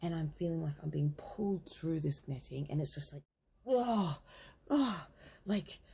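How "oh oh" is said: loud, high mimed gasps; gasping for air. Retold or imitated. Acting out being pulled through netting, loud gasps for air. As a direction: gasp as if surfacing for air. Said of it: "breathing for air".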